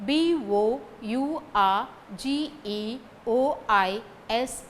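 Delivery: slow, separated letters; spelling out. Shown as a demonstration. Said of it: "So, the spelling".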